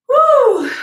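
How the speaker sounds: high, loud victory whoop; excited woo. Real, not acted. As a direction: let out a real cheer of relief. Cheering after finishing set.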